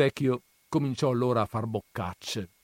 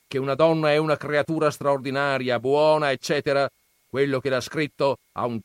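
lightly voiced scornful old grandfather, raised; gruff grandfather; 5 semitones higher, 6 dB louder, faster than usual. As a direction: scoff at the books' dreamers. Grandfather retorts. (nonno burbero accennato, tono sprezzante)

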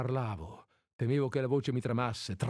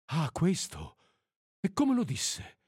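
high-pitched, startled questioning; struck exclamation; 6 semitones higher than usual. A narrator's reading. A woman asking how her husband said it, struck. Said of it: "esclamò ella colpita".

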